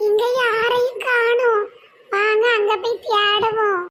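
high, slow lilting call; a high, slow singsong search call; sing-song caller. Acted. High sing-song calling figure.

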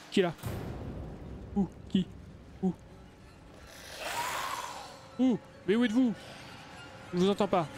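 pitched high, mock searching call. Gamer taunting the hidden enemies, high mock call. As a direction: tease the hiding monsters with a sing-song call.

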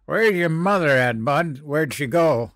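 put-on drunken slur. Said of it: "he slurred".

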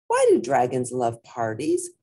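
ordinary delivery

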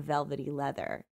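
ordinary delivery, nothing marked

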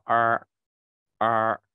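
儿 said far back with a curled tongue; teacher's demo of 儿 as a clear er; retroflex er. Shown as a demonstration. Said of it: "这个就叫后呃".

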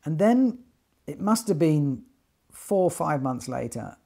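ordinary delivery

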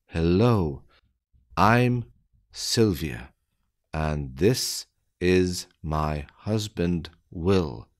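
slow, deliberate, word by word; careful slowed-down reading; slow dictation. Shown as a demonstration. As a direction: spell it out slowly for beginners. Slow repeat for learners.

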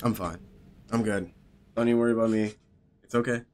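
deadpan sarcasm. Real, not acted. Gamer telling teammate he's fine after dying, deadpan sarcasm. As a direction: insist you're fine, obviously not.

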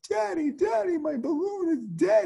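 imitating a crying daughter; crying child. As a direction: whimper it like a heartbroken kid. Daughter voiced crying again.

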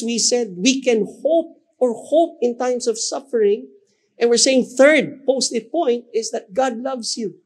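high, ringing declaration; full-force preaching. Acted. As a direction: proclaim it to lift the room.

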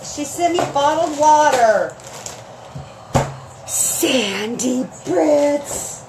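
ordinary talk

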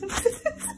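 high, soft giggle. Real, not acted. Realising the finger was over the lens, giggling. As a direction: giggle lightly at your own slip.

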